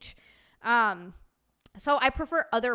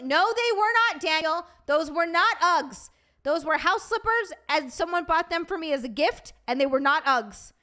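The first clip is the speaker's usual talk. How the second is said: indignant denial, higher and louder; worked-up protest; 5 semitones higher, 5 dB louder than usual. Real, not acted. Streamer denies to Daniel owning Uggs, worked-up protest. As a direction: protest hotly, punching the NOTs. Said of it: "Sorry, I'm getting worked up".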